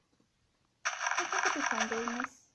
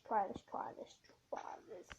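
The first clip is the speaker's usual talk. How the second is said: whisper; 5 semitones lower, 9 dB quieter than usual. Real, not acted. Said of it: "drops to whisper".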